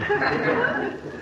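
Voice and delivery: high chuckle. Acted. Narrator's high laugh.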